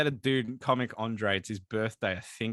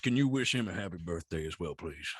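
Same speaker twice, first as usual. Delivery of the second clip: lightly voiced low outlaw, asking politely; a hint of the gruff cowboy pleading; Arthur Morgan voice; 10 semitones lower, 5 dB quieter than usual. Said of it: "Dutch, Dutch".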